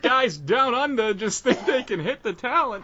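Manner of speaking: high, slow, hammed-up announcer voice; mock commentator. A podcaster imitating TV commentary on his friend, high announcer parody. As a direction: play the wrestling commentator, high and drawn out. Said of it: "They're like".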